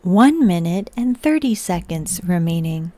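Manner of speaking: measured mechanical countdown announcement; robot voice. Pretend. Streamer calls out time remaining as a robot. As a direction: announce it like an automated system. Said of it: "the robot voice".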